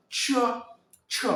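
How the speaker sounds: "tche" as one crisp tch burst; pinyin CH sound. Shown as a demonstration. Coach demonstrating Chinese CH, "tche" said alone. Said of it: "Il y a par exemple le C H".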